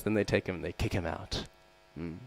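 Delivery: usual speaking voice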